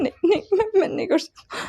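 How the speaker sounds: high, crying refusal; tearful refusal. Acted. Son refusing his mother through tears. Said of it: "वांग जी कहता है".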